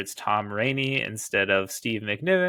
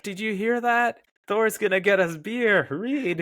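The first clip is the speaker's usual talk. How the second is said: high, loud giddy squeal of news; hammed-up kid thrilled about beer; mock excitement; 11 semitones higher, 4 dB louder than usual. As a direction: gush like an overexcited teenager. Acting out excited young heroes.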